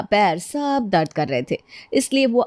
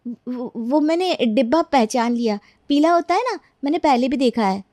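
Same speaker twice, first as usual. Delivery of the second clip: higher-pitched, hesitant meek excuse; nervous servant covering up; 5 semitones higher than usual. Acted. Maid hiding that she can read, nervous. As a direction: fumble for an explanation.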